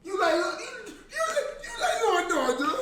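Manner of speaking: high, fast laughing mimicry; mocking high voice. Teasing a friend again in a rushed high voice. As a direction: rattle it off in a high teasing squeak.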